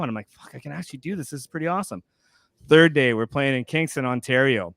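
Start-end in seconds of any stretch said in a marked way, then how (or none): 0.3-2.0 quiet awed self-talk; hushed private thought; muttered thought. Retold or imitated. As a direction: mutter it to yourself, amazed.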